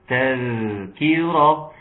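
"تذكرة" slowly, the ذ held as a continuous buzz; soft flowing dhal. Shown as a demonstration. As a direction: let the ذ flow before finishing "تذكرة". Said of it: "لازم تطلعوه". (نطق "تذكرة" برخاوة الذال وإطالة صوتها)

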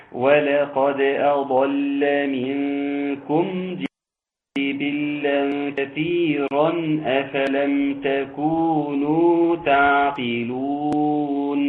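slow, melodic tajweed chant; teacher chanting the verse with full elongations; Quranic recitation. Acted. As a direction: draw each vowel out in a measured chant. Recites verse in one breath.

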